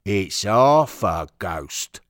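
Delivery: loud, very slow punchline; dramatic reveal. Acted. Steward reveals the worse news to Henry, dramatic slow bellow. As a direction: boom it out, stretching each word.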